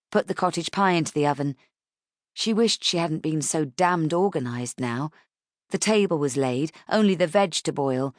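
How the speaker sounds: plain reading voice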